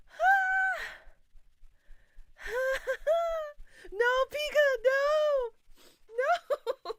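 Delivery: high, loud giggling protest; breathless high laughing no. Real, not acted. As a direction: laugh through a squealing no. Laughing while telling Pika no.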